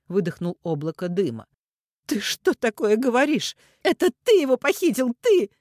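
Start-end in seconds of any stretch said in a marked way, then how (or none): 2.1-5.5 high, loud outburst climbing higher; hysterical accusation. A narrator's reading. Mother accuses the kidnapper, hysterical. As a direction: hurl the blame in panic. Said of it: "Ирина истерично дышала в трубку".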